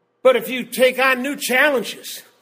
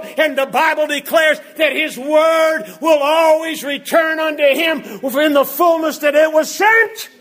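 full-force preaching; 4 semitones higher than usual. Acted. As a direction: preach it hard, line building on line.

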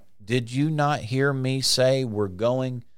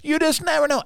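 very high, put-on helpless voice; mocking doubters. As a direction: parody a hand-wringing doubter, pitched way up. Mocks people who doubt the word.

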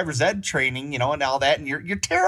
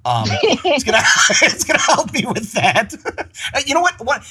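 words bubbling with laughter, higher and louder; laughing through words; 10 semitones higher, 6 dB louder than usual. Real, not acted. Guest jokes he needs the app himself, laughing. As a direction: crack up at your own joke.

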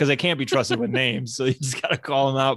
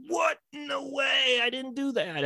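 high, panicked squeak of denial; mock-innocent suspect voice, pitched way up; cartoonish squeaky protest; 14 semitones higher, 5 dB quieter, slower than usual. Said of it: "And the guy's like".

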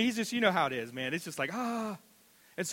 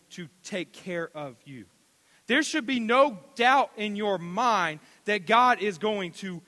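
preaching; 5 semitones higher, 8 dB louder than usual. Acted. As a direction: hammer each promise home. Preaching builds.

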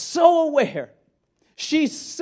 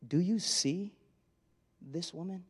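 much softer and lower, you leaned on, rising on woman; quiet, searching question; 6 semitones lower, 13 dB quieter than usual. Shown as a demonstration. Jesus asking Simon again, low and hushed. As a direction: drop low and soft, almost to yourself. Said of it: "I wonder if that was literal".

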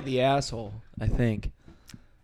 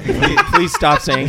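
pleading through laughter; amused exasperation; 5 semitones higher, 7 dB louder, slower than usual. Real, not acted. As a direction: laugh while begging them to stop. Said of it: "driving me insane".

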